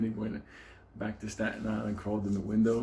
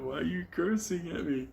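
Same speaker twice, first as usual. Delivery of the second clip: much higher, plaintive; whiny high voice; 9 semitones higher than usual. Retold or imitated. The odd guy asking Ben why he curses, in a high whiny voice. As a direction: whimper it like a hurt loner.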